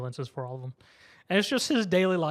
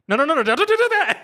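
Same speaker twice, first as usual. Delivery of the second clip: high, loud frantic protest; hammed-up panicked stop, breaking into laughter; mock panic; 11 semitones higher, 10 dB louder than usual. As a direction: cry out in comic alarm. Acted alarm at threat to kill.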